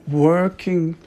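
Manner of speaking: ordinary talk, nothing marked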